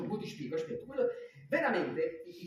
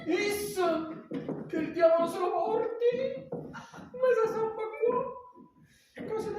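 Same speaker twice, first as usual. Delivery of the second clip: exasperated; 11 semitones higher than usual. Acted. Cursing the patient.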